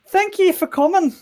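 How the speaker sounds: put-on high, loud accented character voice; retry the character, pitched high and forceful; attempted confident, fierce character. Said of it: "She sounded confident and fierce".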